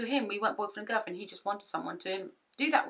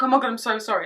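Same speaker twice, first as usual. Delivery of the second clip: flustered apology; 4 semitones higher, 10 dB louder, faster than usual. Retold or imitated. Retold panicked apology for breaking curtain.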